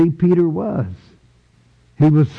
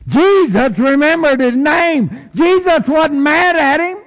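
much higher, ringing with joy; joyful preaching; 12 semitones higher than usual. Real, not acted. Preacher exulting to the congregation about Peter, high and joyful. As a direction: lift it up in exultation.

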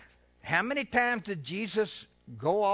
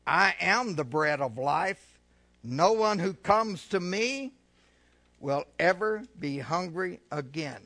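solemn scripture reading; 4 dB louder than usual. Acted. Scripture read.

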